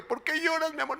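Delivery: high, sweet husband voice; tender husband. Pretend. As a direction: coax a crying wife gently. (marido tierno con voz aguda)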